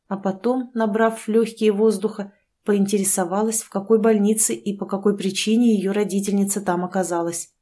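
ordinary delivery, nothing marked

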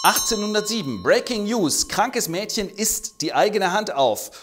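hammed-up news anchor; breaking news flash. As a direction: sell it like a breaking headline.

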